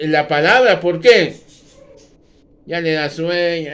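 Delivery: loud, fervent pulpit delivery; fiery sermon voice; preaching at full force. Acted. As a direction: thunder it out to the congregation.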